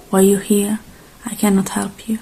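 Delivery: ordinary talk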